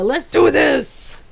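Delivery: drawn-out, high-pitched rallying cry; hyped up. Real, not acted. Streamer rallying the viewers to begin, hyped up. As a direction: stretch it out high, rallying everyone to start. Hyping up before starting.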